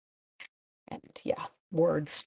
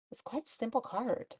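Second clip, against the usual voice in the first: usual speaking voice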